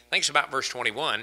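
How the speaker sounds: ordinary talk, nothing marked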